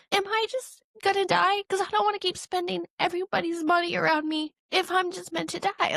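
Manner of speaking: tearful anguished plea. Retold or imitated. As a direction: cry out to God, hurt and desperate. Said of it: "I'm so mad at you".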